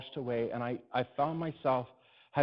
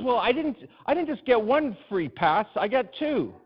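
higher, louder, excited Kenny voice; voicing Kenny, higher; 9 semitones higher, 6 dB louder than usual. Kenny bragging to Alan about two passes, higher and excited. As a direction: puff up like a boy with a secret.

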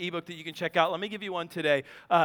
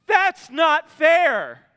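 loud, very slow, higher wronged whine; childish protest; 6 semitones higher, 9 dB louder, much slower than usual. Retold or imitated. Praying child complaining to God, slow loud whine. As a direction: drag it out like a sulking kid. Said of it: "Are we the kid that says".

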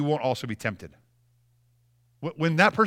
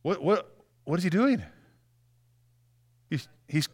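normal talking voice

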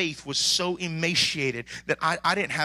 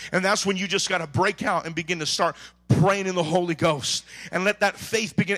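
usual speaking voice

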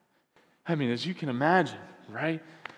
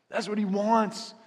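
higher, slower, louder declaration; proclaimed aloud; 7 semitones higher, 3 dB louder than usual. Real, not acted. Pastor proclaiming to the congregation, suddenly loud. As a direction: lift it up and proclaim it. Repeats line, bursts out high.

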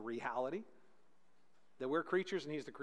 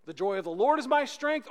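preached refrain; 6 semitones higher, 8 dB louder than usual. Acted.

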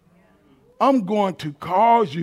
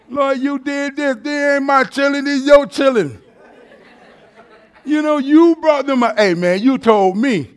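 higher, louder pleading, coaxing God like a buddy; buttering up; 8 semitones higher, 6 dB louder than usual. Retold or imitated. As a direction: sweet-talk the Lord into backing off. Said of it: "he's kinda buttering the Lord up".

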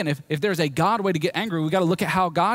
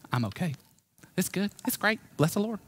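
lightly voiced fake-cheerful churchgoer, soft and rising higher; a hint of a sugary Southern reply; mock fake cheer; 3 semitones higher, 4 dB quieter than usual. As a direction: hide the misery behind a bright brush-off. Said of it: "you can't be that person".

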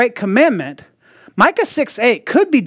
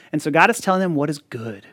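usual speaking voice

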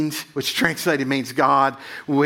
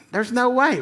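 disbelief; 8 semitones higher, slower than usual. Retold or imitated. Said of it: "And I'm thinking".

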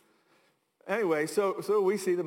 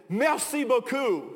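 put-on slow, loud, higher French accent; best French accent; 6 semitones higher, 5 dB louder, slower than usual. Wife thanks the attendant, in her slow best French accent. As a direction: draw out the French thanks with careful effort. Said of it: "again with her best".

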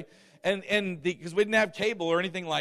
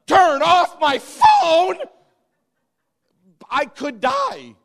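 much higher, louder, slow horrified cry; mock outraged phone addict; 10 semitones higher, 12 dB louder, much slower than usual. Preacher mocking churchgoers told to turn phones off, outraged wail. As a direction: ham up the horror of losing the phone.

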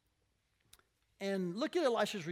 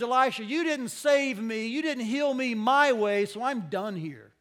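loud, fast sulking outburst from the general; hammed-up fury, fast and loud; mock rage; 3 semitones higher, 9 dB louder than usual. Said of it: "went away in a rage".

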